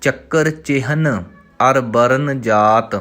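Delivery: drawn out, stretched syllables; slow recitation. Shown as a demonstration. Teacher reciting Gurbani for students, slow and measured. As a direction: chant the word slowly and evenly.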